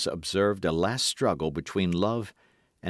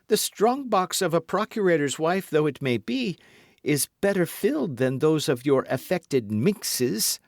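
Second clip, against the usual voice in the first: lightly voiced swaggering musketeer, raised pitch; Porthos voiced; 9 semitones higher than usual. A narrator's reading. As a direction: play Porthos pleading with mock hurt. Wife answers piqued.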